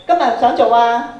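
usual speaking voice

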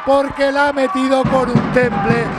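high, loud, slow triumphant commentary; excited goal call. Real, not acted. Commentator goal call after penalty.

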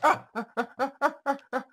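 squeaky panicked yelps; high yelps. Real, not acted. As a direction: yelp high while falling. Falling in game, yelping.

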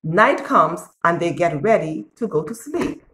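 ordinary delivery

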